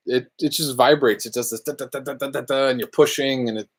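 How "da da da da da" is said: ordinary talk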